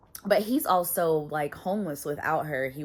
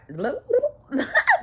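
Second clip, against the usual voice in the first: laugh; 5 dB louder than usual. Real, not acted. Laughs at the producers.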